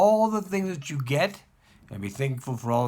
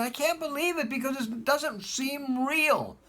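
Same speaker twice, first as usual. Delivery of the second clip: much higher, slow, amazed other voice; disbelieving caller; 12 semitones higher, slower than usual. Retold or imitated. Caller telling the rabbi his luck, stunned disbelief. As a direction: hold it up as if it can't be real. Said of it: "He said".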